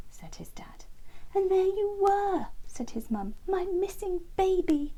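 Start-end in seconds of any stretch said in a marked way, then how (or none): 1.3-2.5 high breathy tender mother; a mother marvelling at her found baby; high breathy mum wren. A narrator's reading. Mum wren finding son. 3.5-4.9 high breathy tender mother; a mother marvelling at her found baby; high breathy mum wren. A narrator's reading. Mum wren finding son.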